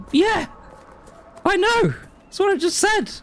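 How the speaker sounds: exasperated shout. Real, not acted. Exasperated at game reminder.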